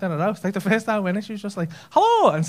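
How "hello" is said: high, loud Glaswegian greeting; mock Glaswegian accent. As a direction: burst into an exaggerated Glaswegian shout. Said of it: "in a fucking Glaswegian accent".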